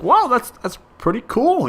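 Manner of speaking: high, lightly amazed wow; a mild mock gasp of wonder. Stoned guy marvelling at talking to himself, high amazed. Recalling his amazed reaction.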